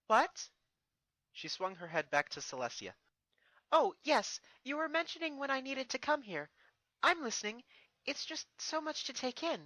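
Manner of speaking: put-on flustered young princess, pitched high; Twilight. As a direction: caught daydreaming, fumble an answer.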